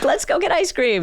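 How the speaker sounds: high, chirpy mock parent offering a treat; cheery parent. Therapist mimics parent cheerfully offering a sad kid yogurt.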